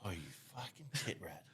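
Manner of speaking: very soft, low grumble; deflated cursing. Real, not acted. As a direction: curse your partner under your breath. Beaten after being caught repeating joke.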